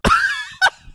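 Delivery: loud, high burst of laughter; high shrieking laugh. Real, not acted. Reacting to the nuts text, loud high laugh.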